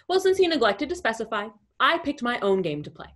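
ordinary talk

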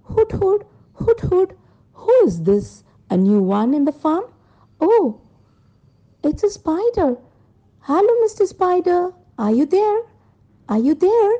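high hooting owl, curious about a newcomer. Acted. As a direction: inquisitive storybook owl, mostly high-pitched. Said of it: "The owl said".

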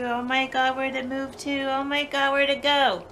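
rising panicked shouts. Real, not acted. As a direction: call out in real alarm. Panics searching for spider.

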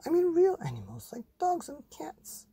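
high breathy mum voice; mum's voice. Acted. Mother explaining real animals, high airy voice. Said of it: "said Mum".

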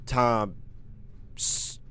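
ordinary talk, nothing marked

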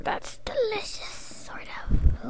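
sarcastic whisper. Acted. Forced to serve, a sarcastic whispered aside. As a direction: whisper it dryly.

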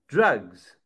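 ordinary talk, nothing marked

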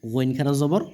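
ordinary talk, nothing marked

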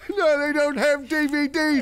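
high, slow put-on Cockney reply; Cockney accent. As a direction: answer like a daft Cockney peasant. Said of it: "doing the Cockney accent".

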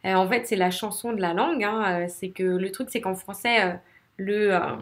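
ordinary talk, nothing marked

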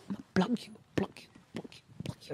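repeated threat fading to a whisper. Pretend. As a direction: drop the voice to a whisper on each repeat.

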